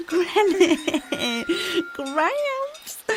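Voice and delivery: high, laughing cries of joy; delighted greeting. Acted. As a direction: greet them like a long-lost child. [name] greets grandparents.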